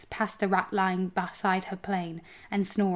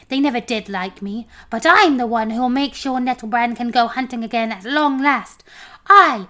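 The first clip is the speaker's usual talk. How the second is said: loud, raised, self-important protest; indignant homunculus; 5 semitones higher, 10 dB louder than usual. A narrator's reading. Homunculus defending himself to the dwarf, loudly indignant.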